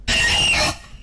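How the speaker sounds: high-pitched gasp of shock; startled gasp. Real, not acted. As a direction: gasp sharply at a jump scare. Jump scare by Screech.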